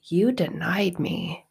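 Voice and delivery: soft, slow and tender; hushed compassionate Jesus; gentle Jesus. Retold or imitated. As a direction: speak softly, like forgiving a friend. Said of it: "And Jesus is like".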